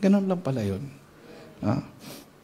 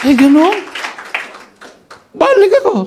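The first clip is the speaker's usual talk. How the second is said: high, loud and lively; lively storytelling punch; animated; 6 dB louder than usual. Retold or imitated. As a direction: burst out with it. Reporting going back, animated.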